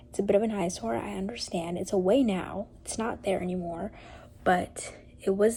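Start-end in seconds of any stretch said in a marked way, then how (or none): none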